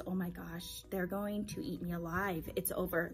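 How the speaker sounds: soft, hushed panic; mock dread. Teacher dreading the first day, hushed panic. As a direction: hush it like a nervous thought. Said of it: "I had been having these".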